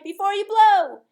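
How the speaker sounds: high, loud shout; children's cry. A narrator's reading. Children urging mother at the candles, loud high shout. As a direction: call out together, thrilled. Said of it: "the children cried".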